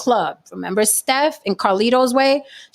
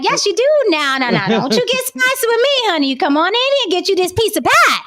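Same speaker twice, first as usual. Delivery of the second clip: high-pitched, hammed-up Southern sass; mock Southern accent; 11 semitones higher, 4 dB louder than usual. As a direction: scold playfully in an exaggerated high Southern accent. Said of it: "British accents or Southern accents".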